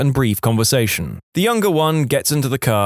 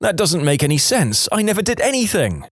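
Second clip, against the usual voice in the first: panicked protest; 6 semitones higher than usual. Acted. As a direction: pitch climbing as you protest innocence. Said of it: "I'm panicking now".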